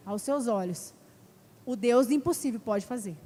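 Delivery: normal talking voice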